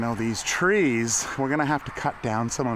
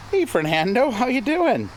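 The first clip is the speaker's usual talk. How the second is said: much higher sing-song greeting; pet talk; 12 semitones higher, 4 dB louder than usual. Real, not acted. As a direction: coo a hello to the animal. Greeting an animal.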